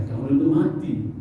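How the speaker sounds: ordinary delivery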